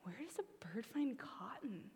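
puzzled question in a whisper; soft whispered puzzlement; whispered wonder. Retold or imitated. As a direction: breathe it, baffled. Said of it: "And I thought".

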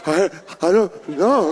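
put-on scared kid. Kid answering scolding mom.

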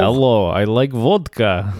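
a light hammed-up Russian accent; mock Russian accent. Shown as a demonstration. Offering his Russian, a light mock Russian accent. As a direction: greet like a cartoon Russian. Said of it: "I could do the Russian".

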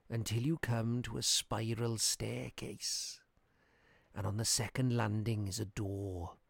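put-on slow, soft old man. A narrator's reading. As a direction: give directions slowly and grimly. Old man gives directions.

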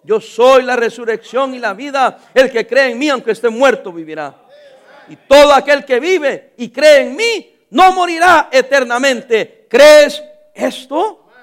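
loud, slow pulpit proclamation; preaching Jesus' words. Acted. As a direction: preach each phrase slowly and loudly. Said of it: "Le dijo Jesús". (proclamación lenta y potente de predicador)

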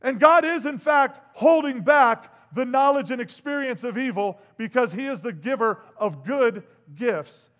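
loud, high sermon peak; booming pulpit delivery; full-force preaching. Real, not acted. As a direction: preach it out to the back row.

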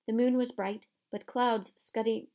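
ordinary delivery, nothing marked

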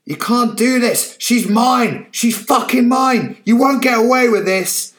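put-on old man raging through the bubble; furious shout. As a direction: bang on the bubble yelling. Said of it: "he shouted through the protective bubble".

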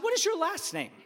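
high, indignant demand; mock-indignant parental quizzing; stern interrogation. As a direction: demand it like an affronted dad. Dad sternly interrogating son.

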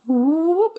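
imitated high whoop; high whoop sound. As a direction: squeak out a rising whoop. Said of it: "Tivo goes".

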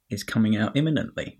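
ordinary delivery

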